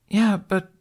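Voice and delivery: put-on child's voice, objecting; young boy about to argue; high child voice, protesting.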